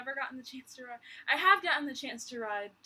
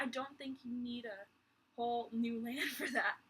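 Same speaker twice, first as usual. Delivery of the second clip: usual speaking voice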